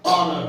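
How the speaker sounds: "ऑनर" hit loud and low, no H; silent H. Shown as a demonstration. Teacher giving the next example, "ऑनर" with silent H. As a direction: punch out "ऑनर" starting right on the O. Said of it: "एच को ना बोल के".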